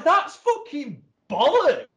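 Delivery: high, loud, slow scornful retort; outraged dismissal; indignant outburst. Retold or imitated. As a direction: spit it out in disgust. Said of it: "I was, like, really kicking off".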